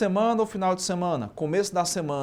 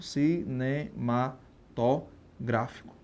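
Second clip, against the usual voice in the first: "cinematográfico" very slow and softer, in separate syllables; 4 semitones lower, 5 dB quieter, much slower than usual. Shown as a demonstration. Teacher spelling out the term for students, "cinematográfico" slowly syllable by syllable. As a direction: break "cinematográfico" into pieces with pauses between.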